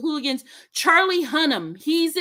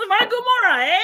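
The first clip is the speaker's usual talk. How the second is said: high hammed-up Canadian tag; mock Canadian; 9 semitones higher, 3 dB louder than usual. Taking co-host's dare to add "eh", mock Canadian. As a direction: tack on a joking Canadian "eh?". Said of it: "throw a little A at the back end".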